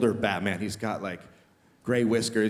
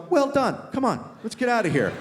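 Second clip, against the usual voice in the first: God's approval; 8 semitones higher than usual. Retold or imitated. God cheering him on.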